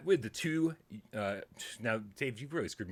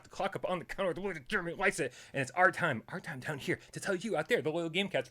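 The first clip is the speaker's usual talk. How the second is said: upbeat host intro voice, pitched up; show opener announcer; 4 semitones higher than usual. Acted. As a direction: rattle off the show's opening spiel. Show opener spiel, raised pitch.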